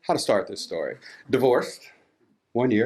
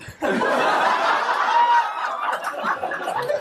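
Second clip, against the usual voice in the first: a long, high-pitched giggle that rises; real high-pitched laughter; high squealing laugh. Real, not acted. Laughing at tying him up.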